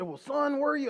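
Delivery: imitated Oklahoma lawman, pitched high; Oklahoma policeman. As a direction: voice a folksy Oklahoma officer.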